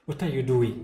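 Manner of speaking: doctor bursting in, shouting at the caregiver; angry shout. A narrator's reading. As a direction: yell it at him in anger. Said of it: "he shouted at Cain".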